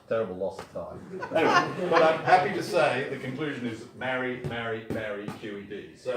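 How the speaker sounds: ordinary talk, nothing marked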